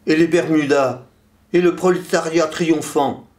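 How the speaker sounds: high-pitched declaimed protest, little added volume; vituperating. A narrator's reading. Poem's third voice joins the uproar, vituperating. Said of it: "vitupérait le troisième".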